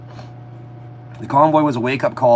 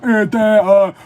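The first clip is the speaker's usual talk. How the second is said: hammed-up stammering filler, higher and louder; mocking halting speech; 6 semitones higher, 5 dB louder, slower than usual. Mocking how the prime minister stalls while he talks. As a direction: imitate a politician stalling for words. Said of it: "that's how he talks".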